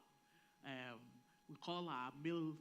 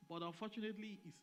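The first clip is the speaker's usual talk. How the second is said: ordinary talk, nothing marked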